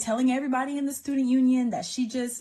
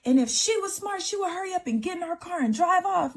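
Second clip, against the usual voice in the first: 1 retold screaming; 8 semitones higher than usual. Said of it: "I'm screaming at him".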